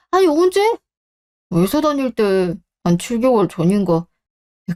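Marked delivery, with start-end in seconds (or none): 0.1-0.6 surprised disbelief at the news; shocked. Acted. Brother learns of the secret loan, shocked.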